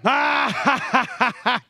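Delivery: loud mock laughter; mocking crowd laugh. Crowd laughing at Jesus, loud acted laughter. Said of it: "the crowd laughed at him".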